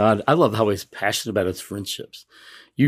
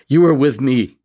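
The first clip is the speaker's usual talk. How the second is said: higher, louder, heartfelt prayer line; lifted, emphatic reading; devotional reading; 4 semitones higher, 7 dB louder than usual. Real, not acted. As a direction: proclaim it to God with conviction. Reads prayer from the Confessions.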